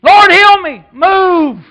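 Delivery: loud, soaring preacher's shout; full-force preaching. Acted. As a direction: preach it, lifting the crowd. Preaching run resumes.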